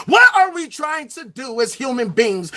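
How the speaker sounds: ordinary talk, nothing marked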